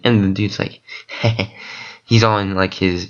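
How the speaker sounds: ordinary delivery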